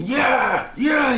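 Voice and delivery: high, loud hammed-up yell; excited yell. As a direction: whoop it up like a wild Nicolas Cage. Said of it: "And he's going".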